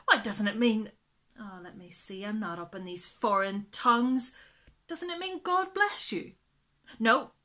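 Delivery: loud, dithering nursemaid voice; muddled old housekeeper guessing a meaning; fussy housekeeper. Acted. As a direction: bluster through, unsure of the French. Mrs Brill voiced in the story.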